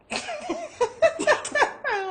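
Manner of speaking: high, loud cackle; burst of laughter. Acted. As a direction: crack up in high peals. Friend laughs after advising.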